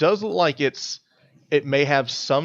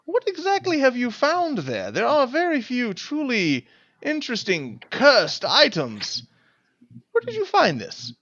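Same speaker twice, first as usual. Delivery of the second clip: put-on high-pitched, curious old scholar; eager high voice; 10 semitones higher than usual. Neville eagerly questions the player about the cursed amulet, in a high voice.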